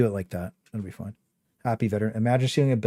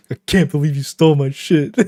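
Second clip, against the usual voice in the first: mock angry kid; 2 semitones higher than usual. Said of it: "He got so mad".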